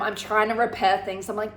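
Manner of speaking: usual speaking voice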